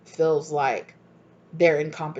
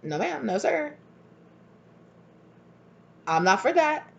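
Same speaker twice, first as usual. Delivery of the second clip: mock polite refusal; 6 semitones higher than usual. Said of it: "and said".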